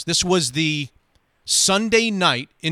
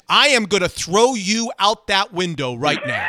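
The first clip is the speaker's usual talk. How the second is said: angry threat; 6 semitones higher, 5 dB louder than usual. Retold or imitated. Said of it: "he says".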